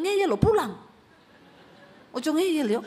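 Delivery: loud, high scolding order; stern command. Retold or imitated. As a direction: order him home, no arguing. God ordering stubborn man home.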